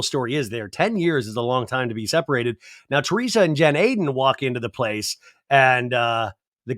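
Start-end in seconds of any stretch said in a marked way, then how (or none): none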